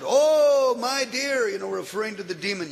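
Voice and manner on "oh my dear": higher, louder hail; loud, friendly hail; calling out. Acted. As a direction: call out to the demon. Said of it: "and he calls out".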